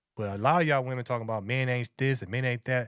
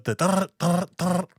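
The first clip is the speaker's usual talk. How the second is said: mock loud talk; 6 semitones higher, 7 dB louder, slower than usual.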